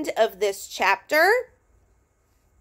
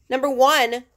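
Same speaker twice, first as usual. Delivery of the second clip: ordinary delivery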